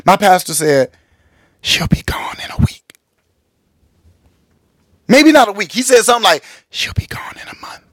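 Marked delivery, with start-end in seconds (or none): 1.6-2.8 unvoiced whisper; whispered under breath. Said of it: "under his breath, like, real, real low". 6.7-7.8 unvoiced whisper. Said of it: "Real low, like, whispered it".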